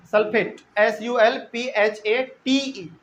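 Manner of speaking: Sulphate followed by एस यू एल पी एच ए टी ई, letter by letter, slowly; slow spelling out. Shown as a demonstration. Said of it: "spelling the word for students". (अक्षर-अक्षर धीरे से बोलना)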